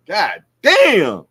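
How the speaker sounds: loud, high acted exclamation; shocked boxer yelling God damn; mock shout. Crawford's reaction acted out.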